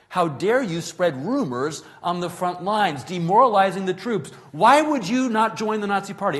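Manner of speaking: loud, high-pitched indignant tirade, retold. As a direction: act out an official working himself into a tirade. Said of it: "he started raising his voice".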